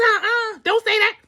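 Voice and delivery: high, loud, playful refusal; retold "no way" in a high voice; alarmed protest. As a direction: shut it down in a high squeal. Retold protest to mother-in-law.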